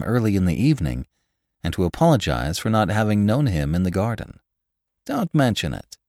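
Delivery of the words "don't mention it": mildly eager, pitched higher; a touch of eagerness, starting high. A narrator's reading. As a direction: reassure eagerly. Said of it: "he enjoined me eagerly".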